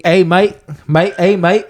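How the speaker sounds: high, put-on Aussie "hey mate"; bad Australian impression. Friend hams up a bad Australian accent for his cohost. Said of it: "You're bad at impressions".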